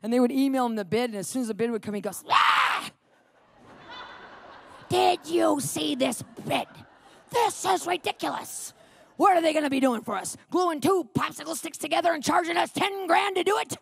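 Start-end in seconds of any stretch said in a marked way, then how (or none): 2.3-2.9 short, loud mock yell of outrage; loud, comic cry of disgust; worked-up yell. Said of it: "he would get all worked up". 4.9-13.8 put-on outrage, building higher; hammed-up indignant ranting; mock rant and rave. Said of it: "rant and rave".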